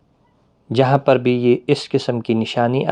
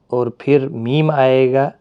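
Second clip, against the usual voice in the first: normal talking voice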